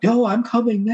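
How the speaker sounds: high-pitched mock call to a roommate; retold calling out. Answering the roommate from the room, high mock calling out.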